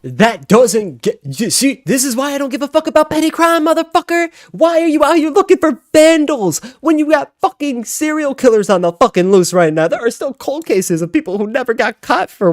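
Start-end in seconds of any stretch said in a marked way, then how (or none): none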